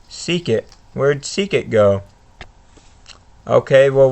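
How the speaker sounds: ordinary delivery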